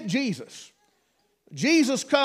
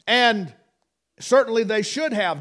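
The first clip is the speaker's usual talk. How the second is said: usual speaking voice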